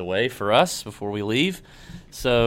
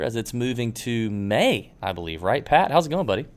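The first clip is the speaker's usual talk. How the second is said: ordinary talk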